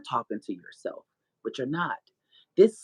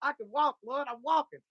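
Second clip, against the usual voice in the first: higher, bursting with joy and disbelief; retelling her first steps, higher, overjoyed and laughing; overjoyed crying out; 8 semitones higher than usual. Retold or imitated. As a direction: relive the moment of first steps, half laughing. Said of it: "I cried so hard".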